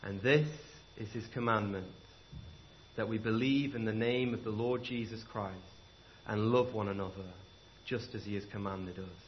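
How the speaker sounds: soft, low solemn reading; preacher reading the verse quietly to close; scripture reading. Acted. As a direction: close with reverent calm.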